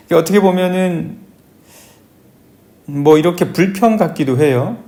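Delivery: usual speaking voice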